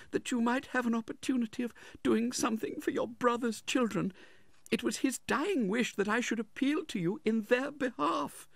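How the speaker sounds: very high, timid widow's voice; faltering plea. A narrator's reading. Widow appeals for her brother-in-law's help, faltering. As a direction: plead haltingly in a high voice. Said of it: "faltered Missus Nickleby".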